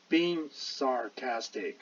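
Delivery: slow, careful "being sarcastic" with each word clear; slow model reading. Shown as a demonstration. Teacher modelling "being sarcastic" slowly for students. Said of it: "你会看到这边有一个be verb".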